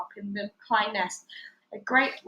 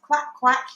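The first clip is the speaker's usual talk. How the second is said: duck quack; 3 semitones higher, 6 dB louder than usual. A narrator's reading. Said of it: "said a voice at the other end".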